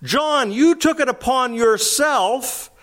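high, slow, coaxing flattery; butter John up, leaning on "you"; flattering disciples. Acted. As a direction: disciples pleading with John about his standing. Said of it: "In other words".

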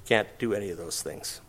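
ordinary talk

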